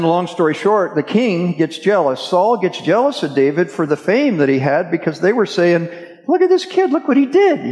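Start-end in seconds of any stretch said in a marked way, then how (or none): 6.3-7.7 excited crowd. Retold or imitated. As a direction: gush like an amazed crowd. Crowd praising David's feat.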